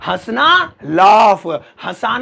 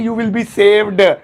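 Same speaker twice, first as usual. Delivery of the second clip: normal talking voice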